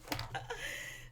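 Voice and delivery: high, squealing laugh; helpless high-pitched laugh trailing off. Real, not acted. Laughs at the low blow.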